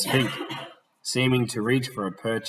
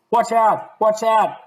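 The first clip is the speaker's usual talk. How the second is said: much higher, louder yell of warning; frantic warning shout; 12 semitones higher, 8 dB louder than usual. Acted. Smith shouting a warning as he collides with the gentleman, high and loud. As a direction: shout the warning as they crash.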